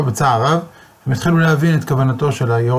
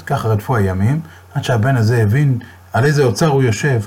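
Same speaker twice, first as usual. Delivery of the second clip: ordinary delivery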